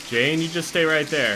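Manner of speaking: ordinary talk, nothing marked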